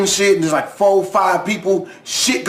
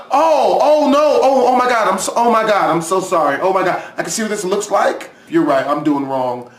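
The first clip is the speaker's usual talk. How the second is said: mock killer backing out sheepishly; hammed-up sorry killer; apologetic killer; 4 semitones lower than usual. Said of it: "And they're like".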